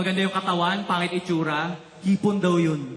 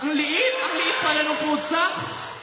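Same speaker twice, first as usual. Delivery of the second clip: exasperated shout; 8 semitones higher, slower than usual. Retold or imitated. Shouting about tiny sock.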